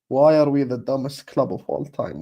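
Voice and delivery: ordinary talk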